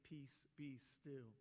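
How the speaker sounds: slow, soft and commanding; calm command. Acted. Jesus speaking to the stormy sea, slow calm command. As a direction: still the sea with quiet authority. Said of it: "rebukes the wind and says to the sea".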